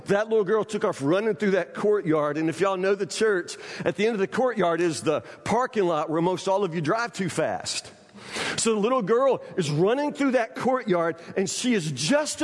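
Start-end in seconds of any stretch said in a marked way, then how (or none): none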